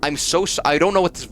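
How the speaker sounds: high, nasal-free squeaky nerd whine. Acted. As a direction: play a scrawny stuttering nerd. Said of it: "I'm doing a stupid nerd voice".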